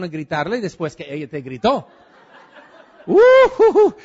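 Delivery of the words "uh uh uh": high, loud burst of laughter; hearty laugh. Real, not acted. To the audience, a loud high laugh at his own point.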